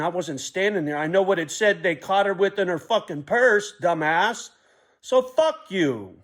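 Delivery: loud, heated rant at a commenter; angry outburst. Real, not acted. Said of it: "sorry for that little outburst".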